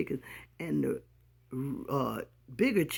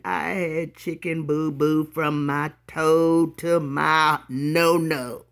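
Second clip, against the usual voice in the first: slow, louder emphasis; drawn out; 6 dB louder, slower than usual. Real, not acted. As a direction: stretch each word for effect. Dramatic emphasis on mess.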